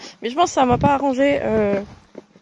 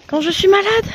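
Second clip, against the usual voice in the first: higher pitched, whiny emphasis; high-pitched repeat; 9 semitones higher than usual. Real, not acted. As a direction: whine it up high.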